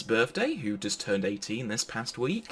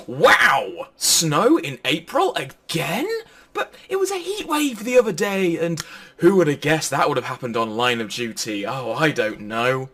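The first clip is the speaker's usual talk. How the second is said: high, loud put-on surprise at the news; mock headline reactions; 5 semitones higher, 7 dB louder than usual. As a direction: play up the shock of each headline. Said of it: "pre-prepared headlines".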